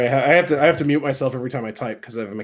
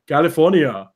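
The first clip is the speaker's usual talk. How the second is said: CALIFORNIA in a thick Austrian accent; Arnold. Shown as a demonstration. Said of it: "he always tries to say it right".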